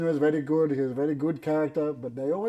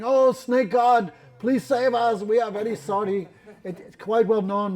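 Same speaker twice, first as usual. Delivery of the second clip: pleading shout; 6 semitones higher, 6 dB louder than usual. Acted. Jatila and Kutila begging the snake god, shouting high. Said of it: "they're too much shouting".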